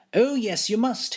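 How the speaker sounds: high pleading insistence; insistent cry. Acted. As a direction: protest it eagerly. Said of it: "his lordship cries".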